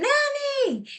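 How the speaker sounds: very high, held cry for help; scream. A narrator's reading. Eloise screams for her nanny after the tutor, very high scream. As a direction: sing out the name in a piercingly high call. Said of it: "And then I scream".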